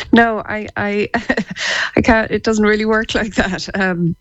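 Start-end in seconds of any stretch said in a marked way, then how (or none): 1.1-1.9 airy laugh. Real, not acted. Embarrassed at being asked to laugh.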